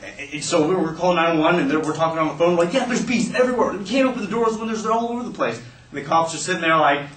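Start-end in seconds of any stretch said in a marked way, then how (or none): 2.7-5.6 high-pitched, acted kid in distress; frantic caller. Kid calling the emergency line, frantic. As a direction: plead into the call, pitched high.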